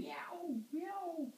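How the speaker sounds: high squeaky meowing; cat meow. Acted. Parent to toddler, meowing like the cat. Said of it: "cried the cat".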